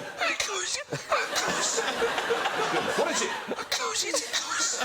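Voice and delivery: high, wheezy asthma gasps; wheezing. Retold or imitated. Telling the gunman about the goose, high breathless wheeze. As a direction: squeeze out goose through an asthma attack. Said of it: "I was going, I started wheezing".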